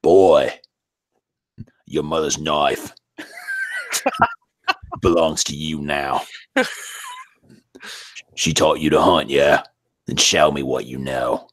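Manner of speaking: Cockney warrior father; stern game hero with a Cockney twist; Kratos. Acted. Game father to his boy, stern Cockney accent. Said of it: "a Cockney Kratos".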